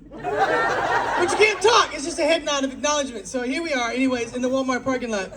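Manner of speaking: usual speaking voice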